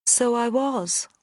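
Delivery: a hint of a lady of the manor, slowly agreeing; posh southern accent. A narrator's reading. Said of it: "in the posh southern accent".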